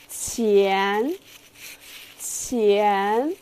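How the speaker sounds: 前 rising in pitch; rising second tone. Shown as a demonstration. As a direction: lift 前 upward. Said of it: "this is the second tone".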